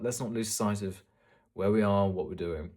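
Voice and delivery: ordinary delivery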